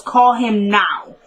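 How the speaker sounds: slow, deliberate weight on each word up to "now"; insistent order. Retold or imitated. Retelling her order to phone Ward, slow and insistent. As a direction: imitate a firm order, "now" drawn out.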